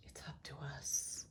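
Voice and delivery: softly whispered repeat; a whispered echo of the card's message. Real, not acted. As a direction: breathe it out as a hushed echo. Card reading, repeats the message.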